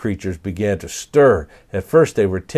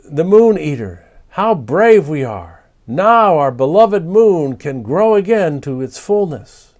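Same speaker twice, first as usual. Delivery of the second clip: proud creatures; 7 semitones higher, 6 dB louder than usual. Acted. Creatures celebrate victory.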